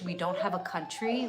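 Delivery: ordinary talk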